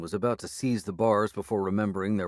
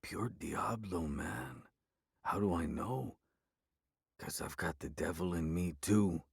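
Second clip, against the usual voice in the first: growly whisper; 2 semitones lower, 8 dB quieter than usual. A narrator's reading. Werewolf telling the narrator about the devil, growly whisper. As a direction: breathe it like a confession. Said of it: "a growly whisper".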